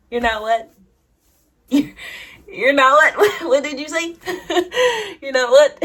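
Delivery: high, loud incredulous question through laughter; laughing disbelief. Retold or imitated. Crush reacting to her confession, laughing in disbelief.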